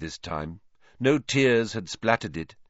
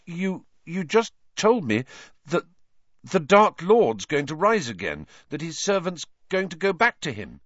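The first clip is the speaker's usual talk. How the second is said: agitated; 8 semitones higher, 3 dB louder than usual. Acted. Harry agitated.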